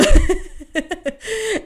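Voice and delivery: bursting into a high laugh; real laugh. Real, not acted. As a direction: let the laugh spill out. Laughing at her own story.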